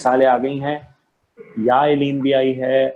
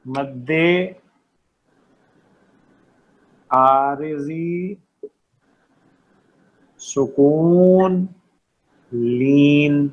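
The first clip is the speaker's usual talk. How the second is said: slow dictation; much slower than usual. Shown as a demonstration. Teacher dictating a term slowly.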